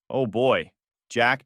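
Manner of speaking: plain reading voice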